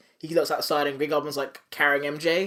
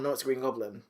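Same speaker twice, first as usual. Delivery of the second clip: lower, softer Goblin side of a back-and-forth; alternating persona; 3 semitones lower, 7 dB quieter than usual. Retold or imitated. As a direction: drop down for the reply. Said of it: "back and forth".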